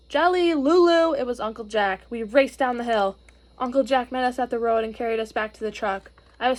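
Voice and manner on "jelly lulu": slow, loud, higher call across a crowd; calling out names. Acted. Uncle calls the lost children across the hill, loud and slow. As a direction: call the children from far away. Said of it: "I heard someone calling our names".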